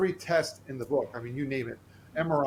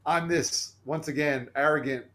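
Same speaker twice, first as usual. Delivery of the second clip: ordinary delivery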